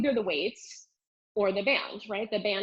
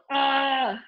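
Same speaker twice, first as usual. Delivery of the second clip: effort groan; 2 semitones higher, 5 dB louder than usual. Real, not acted. Straining through leg exercise.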